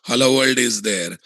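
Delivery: ordinary talk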